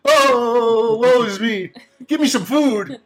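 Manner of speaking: high, hammed-up lament; woe-is-me moan from the bust; whiny bust. Bust voice, frustrated.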